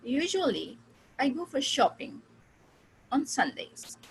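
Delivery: Usually, pause, I go for shopping, pause, on Sundays; thought groups. Shown as a demonstration. Teacher shows students thought-group pauses. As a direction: split it into three spoken chunks. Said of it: "मैंने तीन में divide किया".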